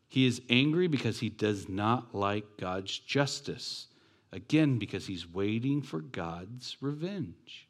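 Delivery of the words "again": normal talking voice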